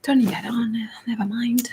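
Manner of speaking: whispering to herself; soft unvoiced mutter; whispered aside. Real, not acted. Aside while setting up.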